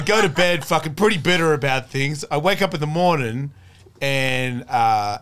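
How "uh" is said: normal talking voice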